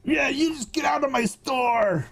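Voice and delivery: high mock yell of an angry clerk; hammed-up order to leave; mock shout. Cabo clerk kicking the customer out, high mock yell. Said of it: "clerk throws him out".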